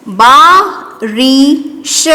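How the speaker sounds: very slow, each syllable stretched; slow dictation. Shown as a demonstration. Teacher dictating to pupils, stretched slowly. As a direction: draw the word out for children. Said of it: "क्या बन गया ये?".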